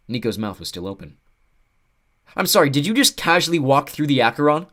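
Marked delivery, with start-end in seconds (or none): 2.4-4.7 raised, disbelieving question; can't believe what he just saw; incredulous. Acted. Nico stunned by the crossing.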